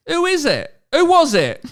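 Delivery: high, loud urgent question; eager Michael. Acted. Reading aloud, Michael begs Hermione for the answer, high and loud. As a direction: demand the answer excitedly. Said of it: "asked Michael".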